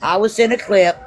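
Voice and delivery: ordinary delivery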